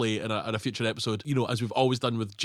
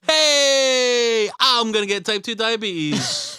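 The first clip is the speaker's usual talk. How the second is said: high, loud jokey self-parody; self-mocking fat guy; 7 semitones higher, 5 dB louder, much slower than usual. Joking to his co-host about being called burgermeister, high and loud. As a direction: ham it up at your own expense. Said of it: "that's what you call me".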